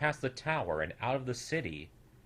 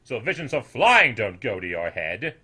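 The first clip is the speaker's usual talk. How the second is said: put-on louder, knowing old voice; condescending elder; 8 dB louder than usual. A narrator's reading. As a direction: talk down to the youngster. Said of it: "the old snoodle said".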